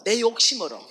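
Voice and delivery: "내 욕심으로" loud at normal pace; emphatic repeat. Shown as a demonstration. ("내 욕심으로"를 힘있게 반복)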